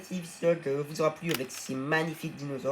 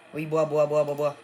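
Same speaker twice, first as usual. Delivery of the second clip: loud, eager coaxing; louder repeated bois, bois; urging pet to drink; 9 dB louder than usual. Real, not acted. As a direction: cheer the dino on to drink. Urging his pet dino to drink.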